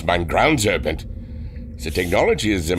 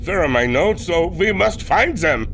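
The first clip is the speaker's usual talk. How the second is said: frantic; 11 semitones higher than usual. Acted. Captain frantic over missing notes.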